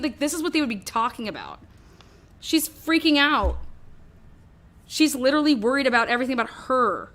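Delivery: normal talking voice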